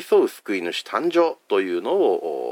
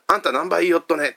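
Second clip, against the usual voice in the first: higher-pitched Hakata comedian line; Hakata dialect; 9 semitones higher than usual. Retold or imitated. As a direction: toss it off like a Hakata manzai comedian. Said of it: "彼らがその方言で漫才をします". (博多弁の漫才風の台詞)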